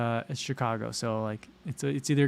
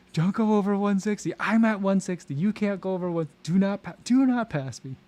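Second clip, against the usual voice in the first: higher-pitched playful pacer calling orders; mock bossy pacer; 7 semitones higher, 4 dB louder than usual. Joking as a pacer policing heart rates, mock bossy. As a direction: pretend to warn runners, pitched up.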